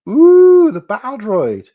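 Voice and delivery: high, loud ooh easing down; excited ooh. Real, not acted. Streamer reacting to battle droid leak, high excited ooh. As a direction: coo with delight, pitched right up.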